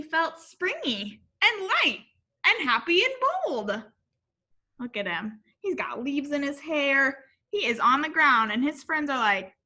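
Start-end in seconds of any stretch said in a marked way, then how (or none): none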